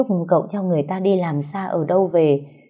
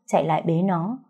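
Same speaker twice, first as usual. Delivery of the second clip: plain reading voice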